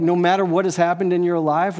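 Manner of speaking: normal talking voice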